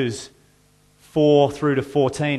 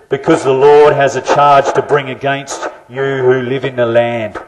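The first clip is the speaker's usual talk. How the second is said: booming, far-off sound, much louder, as if through a megaphone; loud scripture reading through a prop; 13 dB louder than usual. Acted. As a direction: proclaim the prophet's charge through the prop at full volume. Reads Hosea through his prop.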